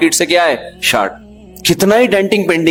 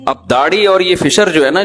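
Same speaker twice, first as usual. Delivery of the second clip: ordinary talk, nothing marked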